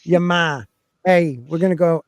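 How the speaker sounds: ordinary talk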